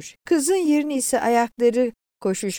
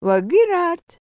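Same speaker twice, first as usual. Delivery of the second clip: high, loud call repeated; insistent conductor's shout; 11 semitones higher, 6 dB louder than usual. A narrator's reading. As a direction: call the stop out loudly. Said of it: "tekrarladı".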